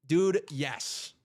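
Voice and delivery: ordinary talk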